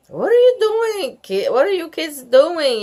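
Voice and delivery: high, loud yard duty yell. A narrator's reading. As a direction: call out to the kids sharply. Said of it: "yelled Rose".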